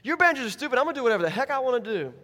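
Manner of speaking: loud, high, rushed backtalk; mock teenage outburst; defiant son. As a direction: talk back to dad in a heated burst. Playing defiant son talking back.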